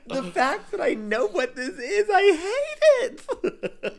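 high, drawn-out words through laughter; laughing. Retold or imitated. Mocking her own reaction to the meme, laughing high. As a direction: laugh helplessly while saying it. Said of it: "And I'm like".

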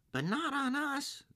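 very high squeaky little voices; high, panicky little oysters; tiny oyster voices. A narrator's reading. Oysters cry out.

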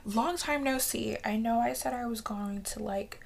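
ordinary talk, nothing marked

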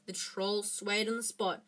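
ordinary delivery

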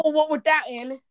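hammed-up British indignation; annoy a friend with a fake British accent; put-on British protest; mock British accent. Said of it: "I was doing that to annoy Chris".

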